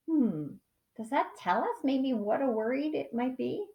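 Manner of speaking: ordinary delivery, nothing marked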